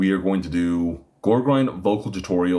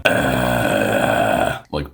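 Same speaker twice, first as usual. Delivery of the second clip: breathy throat growl; 8 semitones lower, 4 dB louder than usual. Shown as a demonstration. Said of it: "It sounds a little more like he's going".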